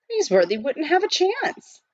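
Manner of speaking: ordinary delivery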